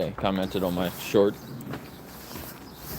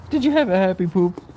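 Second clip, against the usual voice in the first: higher cooing question again; pet talk; 9 semitones higher, 4 dB louder than usual. Real, not acted. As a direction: coo it to the dog.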